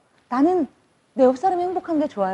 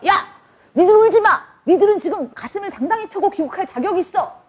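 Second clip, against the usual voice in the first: coach's rallying shout; 8 semitones higher than usual. Retold or imitated. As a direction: bark it like a proud coach consoling his team. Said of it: "막 이렇게 얘기를 하니까".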